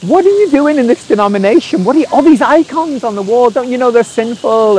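high-pitched, incredulous retold questioning; imitating his scandalised younger self; baffled challenge. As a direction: act out a bewildered teenage objection. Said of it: "this kind of brain freeze thing of going like".

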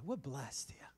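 hushed whisper, almost to himself. Real, not acted. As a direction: drop to a breathy aside. Aside to congregation.

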